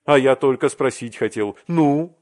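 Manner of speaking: loud, impatient prompting; acted loud eagerness, rising in pitch; eager questioning. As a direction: press him loudly for the news. Arkady eagerly presses for news.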